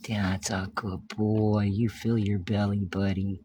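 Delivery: soft whispered praise. Real, not acted. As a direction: whisper to the cat so it keeps eating. Cooing to feeding cat.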